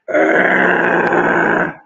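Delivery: long, very low rough growl; deep sustained rumbling roar; tiger growl. A narrator's reading.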